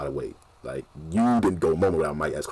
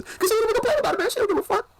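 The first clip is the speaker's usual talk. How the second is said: squeaky falsetto quote; high-pitched impression. Quoting his excuse for robbing a dead woman, falsetto. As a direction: shoot way up high for the punchline.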